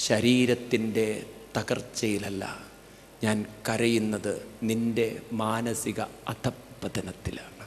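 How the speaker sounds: low, soft, slow lament; sorrowful elder. Acted. Dying Bhishma telling Dharmaputra why he weeps, softly. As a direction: let the old man's grief sink low and slow.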